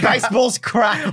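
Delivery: mock shout. Mate mocking the recruiting pitch, slow mock shout. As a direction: bellow it slowly to win Americans over. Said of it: "You go".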